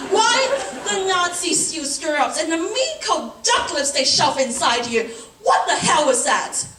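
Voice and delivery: outraged rant. Acted. Woman railing at gynecological exam gear, outraged rant. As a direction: pile up the complaints, pitch climbing.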